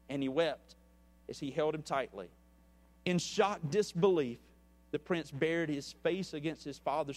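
measured storyteller reading a parable to the congregation; story narration. A narrator's reading. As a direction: tell the tale steadily, letting the quotes land. Reads a parable aloud.

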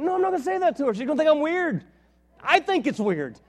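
high, loud panicky refusal; loud protest. Retold or imitated. Preacher arguing with God, high loud protest. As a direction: refuse with rising alarm.

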